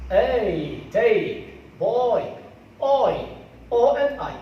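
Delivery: loud, isolated "A" and "oi", each gliding between two vowels; diphthong demonstration. Shown as a demonstration. Teacher models diphthongs for learners, loud drawn-out gliding vowels. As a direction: punch out "take" and "boy", stretching the glide. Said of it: "These are diphthongs".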